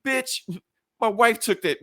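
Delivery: high-pitched, punchy retort; mock indignant. Tony claiming his wife's suit, mock indignant. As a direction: act out an offended comeback.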